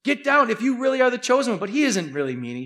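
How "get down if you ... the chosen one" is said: higher, jeering challenge; a criminal's scornful dare; mocking taunt. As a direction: taunt him from the next cross. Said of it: "He's mocking him".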